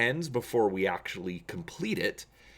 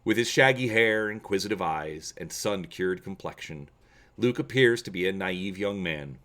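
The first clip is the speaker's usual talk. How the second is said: steady, measured storyteller read; calm audiobook narration, slightly lower; story narration; 5 semitones lower than usual. A narrator's reading. Reads story part one.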